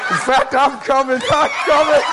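laughing while calling out, building; joyful laughing call; laughing, coming. Real, not acted. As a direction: laugh your way into a shout.

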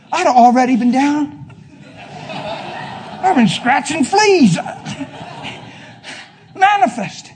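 loud, high, laughing rant; preacher cracking up and getting loud; excited outburst. Real, not acted. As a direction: whip the room up.

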